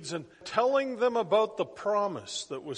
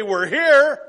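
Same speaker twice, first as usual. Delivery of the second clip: excited shout; 7 semitones higher, 14 dB louder than usual. Retold or imitated. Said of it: "this is how he says it".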